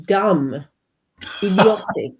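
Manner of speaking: ordinary talk, nothing marked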